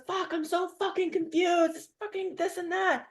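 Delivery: high, slow exasperated cursing; fed-up caller. Retold or imitated. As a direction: whine it out, overwhelmed. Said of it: "he goes".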